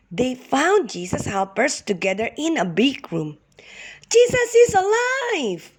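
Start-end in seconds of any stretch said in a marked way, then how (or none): 4.1-5.7 very high, ringing joyful cry. Acted. Women running into the room to tell the helpers, a joyful cry. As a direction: burst in shouting the good news. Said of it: "cried the women".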